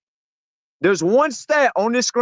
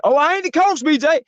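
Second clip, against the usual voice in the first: higher-pitched mock protest; put-on defensive whine, pitched up; mocking excuse; 5 semitones higher than usual. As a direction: imitate someone dodging the question. Mocking an opponent's excuse for dodging.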